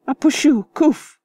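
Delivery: high, loud spluttering gasp. Acted. Bather splutters after a dip in the pond. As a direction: splutter as water hits the face.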